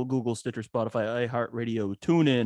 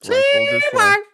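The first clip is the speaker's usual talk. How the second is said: much higher, much louder battle cry; epic shout; 12 dB louder than usual. Acted. Host closing the podcast with a mock battle cry. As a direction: belt it like a rallying call.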